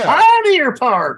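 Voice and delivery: loud, slow, drawn-out announcer call; grand announcement. Real, not acted. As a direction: boom the name like a showman. Announcing park name grandly.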